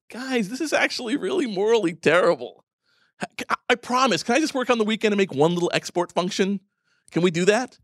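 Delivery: the timid conscience pleading in a meeting; meek little voice. Pretend. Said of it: "that little voice that just goes".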